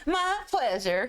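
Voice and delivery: slow, high server's sign-off; put-on sing-song hospitality; customer-service courtesy. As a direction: draw it out like an eager fast-food server. Joins in the server impression.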